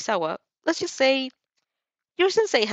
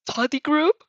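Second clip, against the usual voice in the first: higher, louder, happy call; delighted shout; 7 semitones higher, 4 dB louder, slower than usual. A narrator's reading. Boy bursts in on the study plans, delighted shout. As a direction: blurt it out with glee. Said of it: "a boy shouts in delight".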